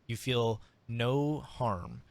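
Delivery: normal talking voice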